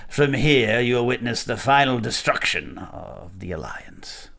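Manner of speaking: slow sinister whisper; Emperor voice. Acted. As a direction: hiss it like the Emperor. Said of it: "the way that you say that, it sounds like it's the Emperor".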